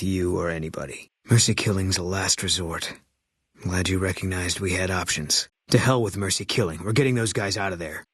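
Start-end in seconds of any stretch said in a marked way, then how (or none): none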